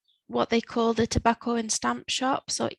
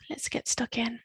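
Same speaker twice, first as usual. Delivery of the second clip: whisper; 6 dB quieter than usual. Real, not acted. Aside after Vamos a ello.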